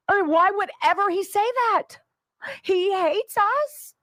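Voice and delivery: mock whining liberal. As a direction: play a wounded victim with fake sobs. Mocking Democrats' wounded claims.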